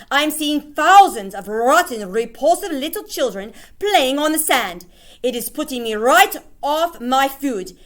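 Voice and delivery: high, loud, ranting witch; screaming witch. Acted. Grand High Witch raging at witches about beach children. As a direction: rant in disgust at the children. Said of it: "she screamed".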